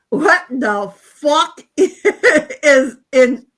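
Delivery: loud, drawn-out Carolinian drawl, breaking into laughter; thick Carolinian accent. Retold or imitated. Quoting a homeless man asked about the band, thick Carolinian accent. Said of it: "in the thickest Carolinian accent".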